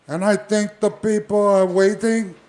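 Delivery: high, loud laid-back wrestler voice; Jimmy Snuka impression. Jimmy Snuka greets Don Morocco before the match, high and loud. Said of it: "I think my conversation would be like this".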